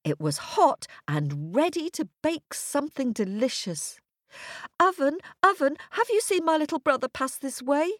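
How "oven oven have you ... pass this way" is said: put-on high, hurried child voice; little girl calling. A narrator's reading. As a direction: call out and ask urgently, high-pitched. Girl calls to magic oven.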